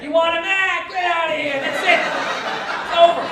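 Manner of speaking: hammed-up pound clerk waving them off; careless pound worker. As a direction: shoo them away with the dog. Pound worker voiced, handing dog.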